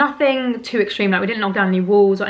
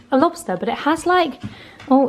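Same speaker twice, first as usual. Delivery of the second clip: normal talking voice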